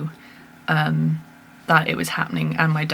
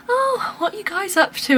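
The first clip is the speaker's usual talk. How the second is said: mother-in-law's greeting; 13 semitones higher than usual. Retold or imitated. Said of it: "she was like".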